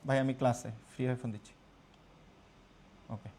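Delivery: low, soft, quick word to a caller; hushed phone aside. Real, not acted. Teacher telling caller he is in class, hushed. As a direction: drop the voice, off to the side.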